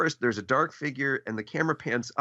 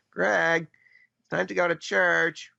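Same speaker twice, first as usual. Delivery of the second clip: higher, louder mom calling her son; 7 semitones higher, 3 dB louder, slower than usual. Retold or imitated. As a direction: call him to church like his mom. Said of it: "that's just my mom voice".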